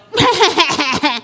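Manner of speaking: high, pulsing mock bawl; imitated child's wail. Older child demanding attention, mock pulsing cry. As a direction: bleat out a fake cry in bursts. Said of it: "when they go".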